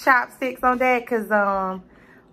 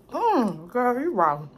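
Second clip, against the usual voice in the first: ordinary talk, nothing marked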